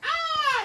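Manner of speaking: sudden very high, loud scream; princess scream. Acted. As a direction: shriek in fright at the monster.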